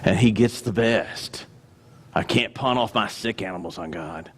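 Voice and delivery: low, grumbling resignation after a sigh; put-on weary complaint; mock reluctant giver. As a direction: grudge it out in a low monotone.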